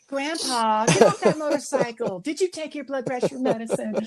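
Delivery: put-on high, scolding young voice; mock scolding grandkid. Kid scolds biker grandpa, high and nagging. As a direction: nag grandpa off the bike like a fussy grandchild.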